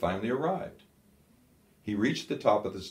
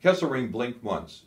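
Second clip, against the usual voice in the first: plain reading voice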